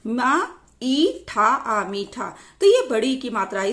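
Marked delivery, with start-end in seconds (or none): 0.0-2.3 slow spelling-out. Shown as a demonstration. Teacher breaking a word into matras, slow spelling-out. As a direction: sound by sound, slowly.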